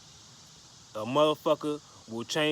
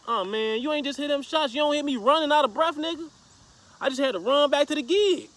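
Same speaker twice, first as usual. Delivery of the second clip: high-pitched, worked-up phone retelling; pitched-up exasperated urgency; retold frantic report; 10 semitones higher, 3 dB louder than usual. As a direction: act out urgently telling a friend what just happened.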